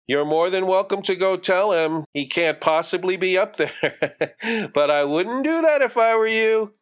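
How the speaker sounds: put-on high-pitched boy, breaking into a giggle; sly giggling dare. Johnny dares his sister to go up, giggling slyly.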